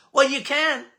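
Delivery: ordinary talk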